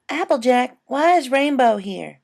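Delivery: put-on a small girl asking her big sister; little filly voice. A narrator's reading. Little sister Apple Bloom asks.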